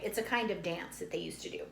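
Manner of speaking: ordinary delivery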